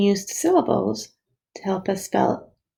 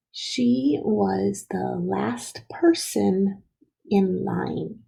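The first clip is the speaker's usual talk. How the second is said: very slow, word-by-word reading; slow dictation; much slower than usual. Shown as a demonstration. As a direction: dictate it slowly for first graders. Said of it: "is going to say".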